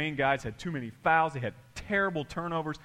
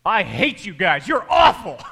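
high, loud shout of disgust; loud mock outburst; 5 semitones higher, 13 dB louder than usual. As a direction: blurt out the insult. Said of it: "He came in and he said".

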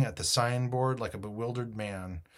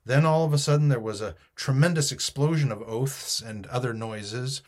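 ordinary delivery, nothing marked

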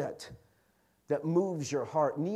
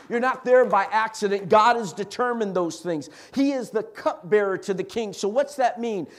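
raised, driving sermon voice; impassioned preaching; 8 semitones higher, 8 dB louder than usual. Real, not acted. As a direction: preach it hard, piling up the examples.

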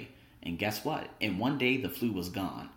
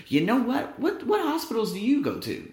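high, louder eager question; excited question; 10 semitones higher, 3 dB louder than usual. Retold or imitated. Asking his student which hospital, high and eager. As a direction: jump up high and ask eagerly.

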